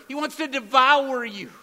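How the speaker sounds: "devour" punched loud and high, the stronger restatement; loud stress on devour. Shown as a demonstration. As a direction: hammer the word "devour" as the clearer version. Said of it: "or even clearer".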